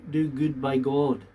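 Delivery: ordinary talk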